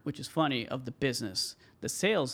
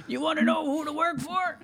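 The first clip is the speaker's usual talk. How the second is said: voicing the other man; 13 semitones higher, 3 dB louder than usual.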